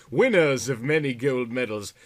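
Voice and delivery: ordinary delivery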